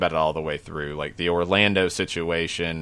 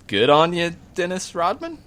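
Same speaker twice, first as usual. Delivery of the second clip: cheerful praise; 10 semitones higher than usual. Real, not acted. Cheering Rodman.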